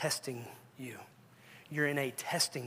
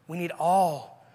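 ordinary talk, nothing marked

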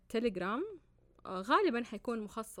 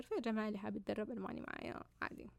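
ordinary talk, nothing marked

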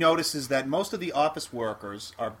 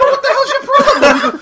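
loud and high, laughing; laughing shout; 13 dB louder than usual. Retold or imitated. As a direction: yell it through laughter. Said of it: "I go".

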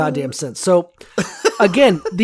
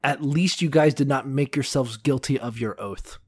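whisper; 5 semitones lower, 8 dB quieter than usual. Acted. Mock-reassuring the kidnapped women's men.

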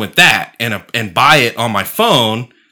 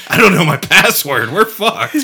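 laughing mock panic; 5 semitones higher than usual.

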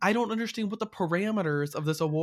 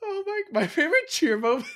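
breaking up with laughter mid-line; can't get the words out for giggling; laughing; 13 semitones higher than usual. Real, not acted. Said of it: "can't say it without laughing".

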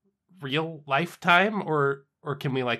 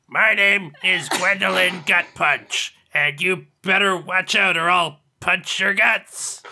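slow, menacing put-on brawler voice; tough character voice; 3 semitones higher, slower than usual. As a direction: growl out a tough-girl threat. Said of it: "I'll keep trying".

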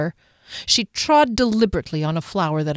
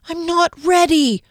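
high, louder desperate cry; a frightened woman cries out from hiding; panicked cry; 10 semitones higher, 5 dB louder than usual. A narrator's reading.

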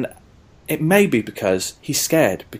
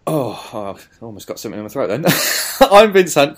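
usual speaking voice

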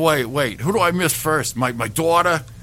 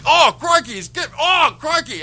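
high, shouted Aussie cry; mock dying crikey; 8 semitones higher, 3 dB louder than usual. Croc hunter acts out the stingray attack, in a mock Australian shout. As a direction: replay the last cry, high and loud. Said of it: "It's like".

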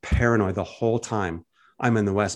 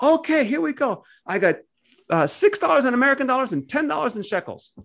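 imitating cheerful local; 12 semitones higher than usual. Palestinian man reassuring the Americans he can give change, much higher and cheerful. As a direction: sing it out like an easygoing shopkeeper. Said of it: "the guy comes out".

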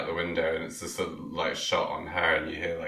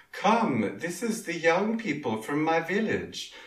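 a hint of a Swedish accent, higher; lightly put-on Swedish lilt; 10 semitones higher, slower than usual. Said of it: "verging on an accent".

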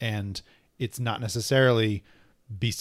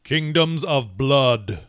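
slow, loud title reveal; dramatic title announcement; 3 semitones higher, 5 dB louder, much slower than usual. Acted. Revealing next issue's title, slow dramatic announcement.

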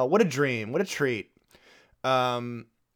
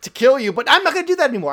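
higher, louder, nervously laughing excuse; mock excuse; 7 semitones higher, 4 dB louder than usual. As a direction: play the caught husband fumbling a lie, laughing. Husband's excuse acted out, laughing.

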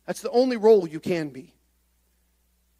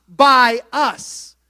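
normal talking voice

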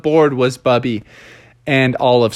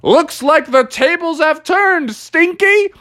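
high gloating taunt; Bubby gloating as he jumps; 12 semitones higher, slower than usual. Acted. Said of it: "he said".

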